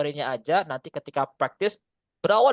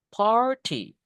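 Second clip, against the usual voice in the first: "Party" stretched on the first syllable, falling away; stressed noun; 5 semitones higher than usual. Shown as a demonstration. Teacher isolating the stressed word, first syllable stretched. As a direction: lean hard on the start of "Party". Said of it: "Penekannya di party".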